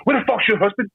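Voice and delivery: high, raging demand; mocking furious demand. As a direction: turn and demand in a rage. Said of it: "I turned and I was like".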